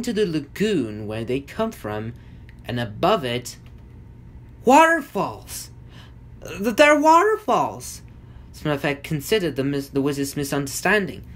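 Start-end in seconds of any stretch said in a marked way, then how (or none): 4.6-8.0 high, loud, drawn-out exclamation; big high-pitched reveal, falling at the end; exclaiming. Acted. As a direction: cry it out slowly, pitched way up. Correcting the wizard's mistaken landmark.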